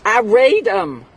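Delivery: loud, high, drawn-out READ; exasperated retort. Retold or imitated. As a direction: answer a silly question with loud indignation. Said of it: "what do you do with them?".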